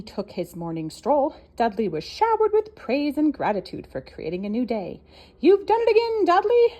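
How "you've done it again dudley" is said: high, loud cheery turkey. Acted. Trevor the turkey praising Dudley, high and loud. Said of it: "said Trevor the turkey".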